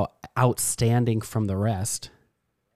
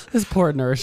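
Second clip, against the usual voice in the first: normal talking voice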